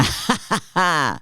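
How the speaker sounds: imitated villainous chuckle; put-on gloating laughter; mock gloating laugh. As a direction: laugh as the smug man would. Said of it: "you think he's, like, somewhere".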